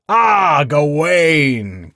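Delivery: loud, high, booming welcome; hearty greeting. A narrator's reading. Lord Bertilac hails Gawain across the great hall, loud and hearty. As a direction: call out across the hall with delight. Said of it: "came the rich baritone of Lord Bertilac".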